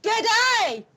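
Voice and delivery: a high, loud Aussie "Good day"; Australian greeting. Pretend. Host greeting in mock Australian, high and loud. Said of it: "I've even got my accent".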